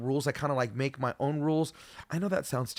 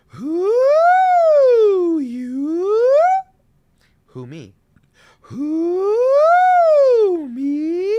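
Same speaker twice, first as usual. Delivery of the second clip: sliding siren up into falsetto; 14 dB louder, much slower than usual. Shown as a demonstration. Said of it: "we do it like this".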